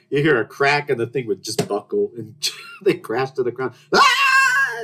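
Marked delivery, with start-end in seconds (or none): 3.9-4.8 high yelp as the table gives way; a startled shriek from the fall; mock scream. College kids crash off table.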